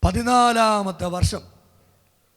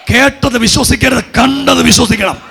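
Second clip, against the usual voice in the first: raised, emphatic preaching voice; forceful exhortation; 3 semitones higher, 8 dB louder than usual. Real, not acted. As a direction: drive the maxim home to the congregation. Repeats the maxim forcefully to congregation.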